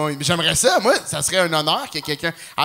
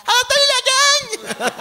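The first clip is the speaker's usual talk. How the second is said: far higher, loud cartoon greeting; squeaky hammed-up showbiz hello; mock comedian impression; 6 dB louder, faster than usual. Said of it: "Il va se faire imiter". (imitation exagérée d'humoriste, voix très aiguë)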